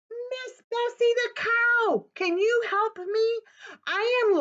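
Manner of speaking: put-on high, pleading little boy; small boy begging the cow for help. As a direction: ask sweetly in a high child's voice.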